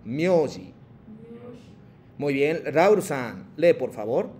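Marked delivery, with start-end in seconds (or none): 0.0-0.7 myoji repeated clearly as a model; teacher says myoji again for the class; one clean model of myoji; model pronunciation. Shown as a demonstration. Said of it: "Otra vez".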